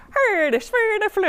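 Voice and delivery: put-on high, sing-song Swedish accent; mock Swedish gibberish in a Swedish accent. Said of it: "it sounded a little like".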